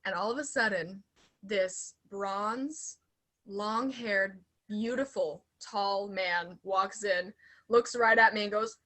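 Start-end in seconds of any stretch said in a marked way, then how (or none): none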